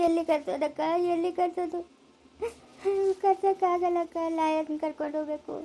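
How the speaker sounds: tearful lament, words drawn into a wail; bereaved mother's helpless weeping; crying. Acted. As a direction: pour out your grief through tears. Tearful helplessness about fetching husband.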